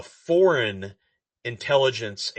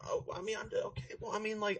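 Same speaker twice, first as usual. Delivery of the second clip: whispering; 10 semitones higher, 13 dB quieter, faster than usual. Real, not acted. Mumbled aside under breath.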